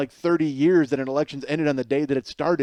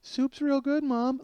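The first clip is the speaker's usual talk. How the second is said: put-on high, sing-out little-kid voice; placating little brother; 13 semitones higher, slower than usual. Little brother trying to make mom happy, eager high voice. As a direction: pipe up high to please mom.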